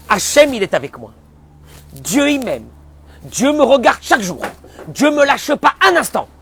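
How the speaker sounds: loud, fervent declaration of faith; simple faith declaration. Shown as a demonstration. Rabbi voicing a simple believer, loud and fervent. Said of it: "parler comme quelqu'un de simplé".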